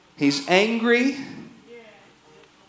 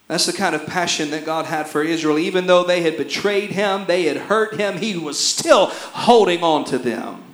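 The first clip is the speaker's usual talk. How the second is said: ordinary talk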